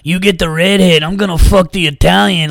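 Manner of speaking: hammed-up gangster threat; menacing drug dealer. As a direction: play a cartel heavy, half joking. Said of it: "these drug dealers be like".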